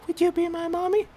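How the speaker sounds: put-on very high, soft little-kid plea; toddler voice. Dad voicing his son asking a stranger, high toddler squeak. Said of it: "and Nolan doesn't go".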